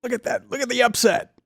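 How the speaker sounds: high-pitched excited exclaiming, playfully imitated; imitating excited colleague. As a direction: mimic an engineer thrilled by test results. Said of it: "because he's so excited".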